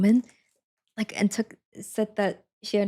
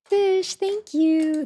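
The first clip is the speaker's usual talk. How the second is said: excited thanks; 9 semitones higher, 6 dB louder, much slower than usual. Real, not acted. Thanking a donor with delight.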